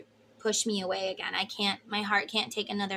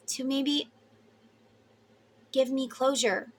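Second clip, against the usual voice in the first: slow, earnest prayer to Spirit; spoken prayer; 5 dB louder, slower than usual. Acted. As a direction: pray aloud, calling for courage.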